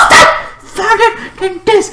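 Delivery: bar the way, escalating into a high yell; dramatic mock warning; commanding block. Acting out a character blocking the way.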